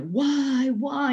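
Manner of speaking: father aghast at drama school plans, crying out high; horrified father. Retold or imitated. Father horrified at drama school plan, shrill high why.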